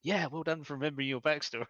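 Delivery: high, loud mock praise; game master teasing a player who forgot his sister; sarcastic GM. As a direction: lay the sarcasm on thick. GM's sarcastic reply, retold.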